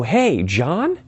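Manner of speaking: high, questioning call of recognition; surprised recognition. Acted. Speaker spots old classmate on street, tentative surprised greeting. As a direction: call out as if spotting someone you know.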